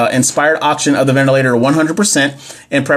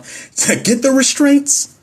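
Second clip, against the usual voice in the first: mock incredulous; 11 semitones higher, slower than usual. Mocking a bad answer.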